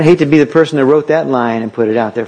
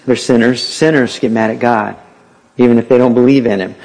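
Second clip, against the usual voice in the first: normal talking voice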